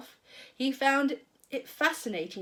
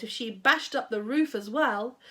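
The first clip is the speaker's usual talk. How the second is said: plain reading voice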